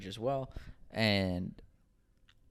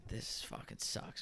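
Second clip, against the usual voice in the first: шёпотом, с досадой; mock whisper; 13 dB quieter, slower than usual. Retold grumble inside the cell.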